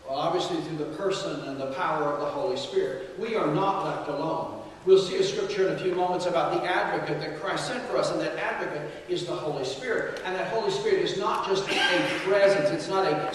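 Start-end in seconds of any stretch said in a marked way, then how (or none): none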